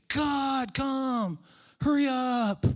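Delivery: high, loud, drawn-out plea; hammed-up shouted prayer for haste; begging. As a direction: cry out to heaven, impatiently begging. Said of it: "I've begged him".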